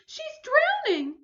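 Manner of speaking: very high, loud panicked call; frantic cry. Acted. Mother screaming for Clover in the water. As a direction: scream for the drowning child. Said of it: "cried Missus Hipple".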